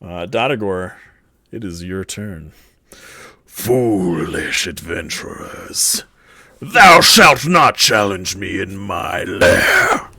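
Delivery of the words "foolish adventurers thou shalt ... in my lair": slow booming villain proclamation; booming demon lord. Acted. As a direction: boom it out like a dungeon boss. DM voices boss challenging party.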